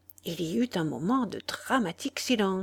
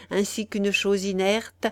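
usual speaking voice

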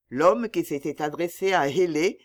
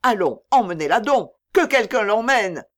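loud, raised, dry command; curt; 5 semitones higher, 8 dB louder than usual. A narrator's reading. Trader having the wailing mother removed, loud curt order. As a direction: order it impatiently, no sympathy. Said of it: "dit Hélé sèchement".

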